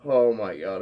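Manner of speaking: usual speaking voice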